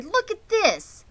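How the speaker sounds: high, slow eager exclaim; thrilled boy. A narrator's reading. Avery shows the family the blue tag, amazed. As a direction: point it out, amazed. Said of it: "cried Avery".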